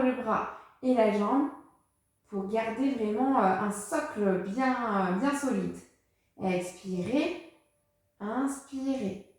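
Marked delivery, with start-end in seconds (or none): 6.4-9.3 slow, soft, drawn-out cues; softly guiding breaths in and out, slowly; slow breathing cues. Real, not acted. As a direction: stretch each word to pace the breath. Teacher paces the students' breathing.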